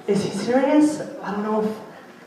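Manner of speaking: puzzled friend trailing off; confused friend. Retold or imitated. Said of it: "she's like".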